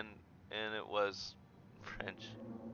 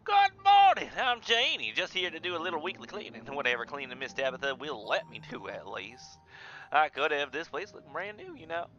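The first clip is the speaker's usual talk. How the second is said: Southern woman; 3 semitones higher than usual. Acted. Janie introducing herself to Henry, chirpy Southern accent. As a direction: chatter away as a Southern cleaning lady. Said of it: "Southern woman".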